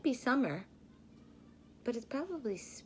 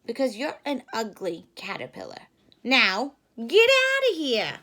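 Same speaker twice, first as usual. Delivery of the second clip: mean monkeys; 13 dB louder than usual. Acted. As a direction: chase him off with scorn. Monkeys shooing him away.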